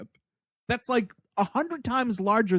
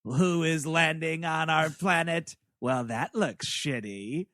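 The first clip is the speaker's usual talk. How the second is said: slow, low robot voice; drawn-out voice of an unimpressed little robot; little robot head; 2 semitones lower, slower than usual. Retold or imitated. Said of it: "up comes this little robot head".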